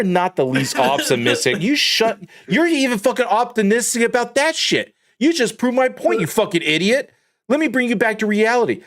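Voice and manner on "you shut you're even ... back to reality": pitched-up, fired-up ribbing; heated roast. Acted. Roasting his friend for being optimistic, high and heated. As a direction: go after him, high and heated.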